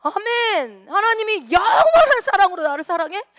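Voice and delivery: high, loud overjoyed cry; thrilled amen. Pretend. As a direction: burst out with delighted disbelief. Overjoyed reaction to scripture.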